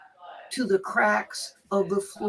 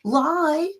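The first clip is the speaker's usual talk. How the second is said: imitating a hurt, tearful plea, higher and slow; mock-tearful wounded innocence, pitched up; 6 semitones higher, slower than usual. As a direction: plead it word by word, drawn out. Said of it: "She was standing there in tears".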